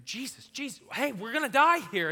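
high, loud frantic cry; frightened sailors screaming over a storm; panicked plea. Acted. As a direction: yell in terror for help. Disciples wake Jesus in storm.

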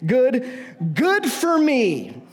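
drip with mock cheer, drawing out good; imagining being Joseph, sarcastic and defeated; sarcastic resignation. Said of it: "I'd say".

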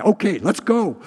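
loud, high, instant reply; hurried agreement. Retold or imitated. Preacher as Jesus rushing to the official's house, hurried and loud. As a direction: jump straight into action. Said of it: "if I had been Jesus".

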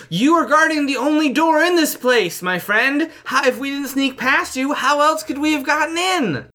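loud, high-pitched shout. Acted. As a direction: shout it at the guard. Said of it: "I'm just gonna shout".

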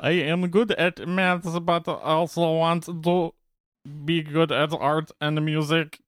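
slow, high robot voice; metallic voice. Retold or imitated. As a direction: drag it out like a clunky robot. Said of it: "That's terrible".

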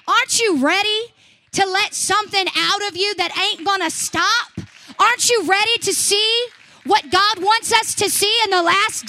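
loud, high, shouted revival preaching; rally the congregation, voice soaring; preaching at full force. Acted.

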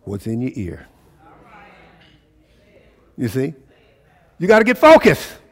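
high, loud, fervent sermon; rising preacher's fire; full-force preaching. Acted. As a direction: drive it home to the congregation.

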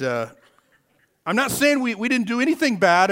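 ordinary delivery

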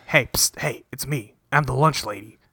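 low hushed secret-agent whisper; Jerry whispering to the girls from disguise; imitated whisper. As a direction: whisper it like a spy in hiding.

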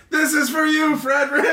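high laughing scream. Acted. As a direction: shriek it out while laughing.

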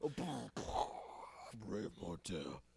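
slow, soft, sultry lady voice; man doing a woman's voice. Pretend. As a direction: purr it like a sexy radio lady. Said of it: "random sexy lady voice".

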